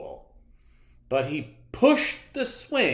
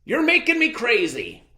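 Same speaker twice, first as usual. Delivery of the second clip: exasperated friend; 9 semitones higher, 4 dB louder than usual. A narrator's reading. Best friend to Clark, fed up and pleading. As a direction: sigh it out, fed up.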